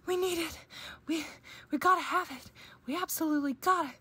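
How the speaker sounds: high, loud, broken-up pleading; out of breath, excited. A narrator's reading. Cubs beg their parents for something, breathless and excited. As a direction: plead urgently in breathless bursts. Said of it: "so out of breath they could hardly talk".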